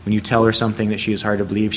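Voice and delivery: ordinary delivery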